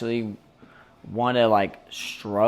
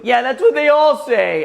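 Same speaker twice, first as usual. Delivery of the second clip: high, louder sulky voice; the stranger answering bitterly, hammed up; mock bitter retort; 11 semitones higher, 7 dB louder than usual. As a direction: sulk it back at him. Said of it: "stranger's bitter retort".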